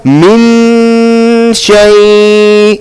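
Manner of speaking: chanted min shay with a full, held n in min; noon not hidden. Shown as a demonstration. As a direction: land directly on the n of min and hold it. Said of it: "We can't recite min shay".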